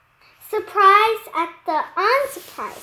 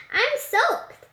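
put-on girl character voice; 7 semitones higher than usual. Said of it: "explained Emerson".

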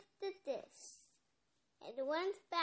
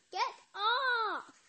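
high, loud "Get off!" yelled from under the pile; loud, high "Get off!" cry; 7 semitones higher, 7 dB louder than usual. Acted. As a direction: yell it as the weight lands on you. Cat crushed under the pile.

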